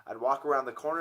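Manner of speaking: ordinary delivery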